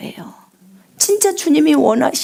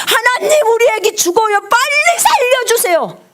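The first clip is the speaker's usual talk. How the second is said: high, crying plea, voice cracking; desperate wailing prayer; 11 semitones higher than usual. Shown as a demonstration. Mother begging God for her dying baby, wailing high. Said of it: "거의 미친 듯이 울부짖어요".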